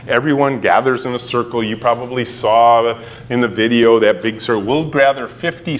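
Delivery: normal talking voice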